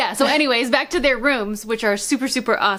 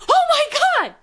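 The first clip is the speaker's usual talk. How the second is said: high startled cry of shock; shocked gasp. Retold or imitated. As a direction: react like someone just fell. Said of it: "we were both like".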